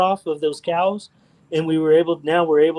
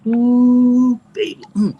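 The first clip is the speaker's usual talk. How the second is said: admiring coo; 7 semitones higher, 4 dB louder, much slower than usual. Real, not acted. Admiring a beautiful weld.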